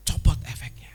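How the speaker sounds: unvoiced whispered aside. Real, not acted. Preacher whispering to the congregation to drop the act. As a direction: breathe it out as a secret. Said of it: "whisper aside".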